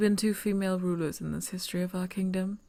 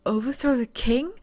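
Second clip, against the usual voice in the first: blurted out in louder alarm; shocked outburst; 4 semitones higher, 7 dB louder than usual. Acted. Princess blurting alarm at the stranger's plan to overthrow the king. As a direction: burst out in disbelief.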